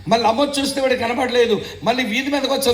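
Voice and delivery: normal talking voice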